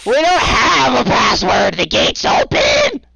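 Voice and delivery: very high, loud, slow giggling creature yell; laughing guard shout. Acted. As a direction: shout down, barely holding in laughter. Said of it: "He goes".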